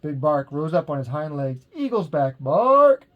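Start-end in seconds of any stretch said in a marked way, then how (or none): 1.7-3.1 barking alarm. A narrator's reading. Watchdog warning, barking.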